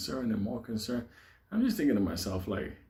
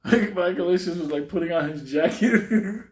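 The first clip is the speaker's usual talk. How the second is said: higher, louder, breaking into laughter; laughing; 5 semitones higher, 6 dB louder than usual. Real, not acted. Storyteller describes friend getting ready to run, laughing. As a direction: tell it while cracking up.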